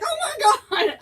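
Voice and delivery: high, loud oh my God; shocked. Retold or imitated. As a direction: gasp it out in shock. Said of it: "And I'm like".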